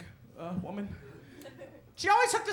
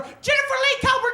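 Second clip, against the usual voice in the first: mama bellowing the full name, higher and louder; mother's full-name yell; 9 semitones higher, 5 dB louder than usual. Retold or imitated. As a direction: call the kid in like an enraged mom. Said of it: "that's when my mama lost her damn mind".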